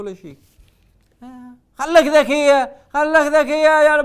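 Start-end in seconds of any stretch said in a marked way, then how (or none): 1.8-4.0 loud mock scolding; loud teasing rebuke. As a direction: tell her off loudly, half joking. Host scolding caller loudly.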